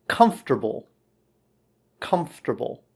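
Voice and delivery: comfortable as KUMF-ter-bul, falling; model pronunciation. Shown as a demonstration. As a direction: model "comfortable" in three syllables, FT together. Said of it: "couple of pronunciation things".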